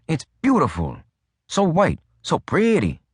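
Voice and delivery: put-on lightly affected Latin accent, admiring the snow; a touch of a Latin accent; mock Latin accent. A narrator's reading. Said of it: "affect a distinct Latin accent".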